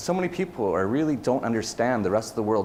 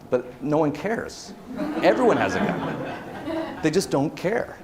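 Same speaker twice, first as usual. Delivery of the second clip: laughing and slowing down, pitched up; 6 semitones higher, slower than usual. Real, not acted. Telling a friend about Mexico, laughing. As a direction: laugh through the words as it amuses you.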